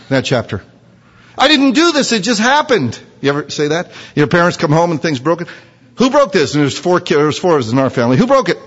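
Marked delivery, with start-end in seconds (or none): 1.4-3.0 much higher, mock-innocent whine; whiny excuse. Aaron excusing himself to Moses, high innocent whine. 6.0-6.5 mock stern parent. Parent demanding. 8.2-8.6 high, demanding parent voice; mock stern parent. Parent questioning the kids about a broken thing, high and demanding.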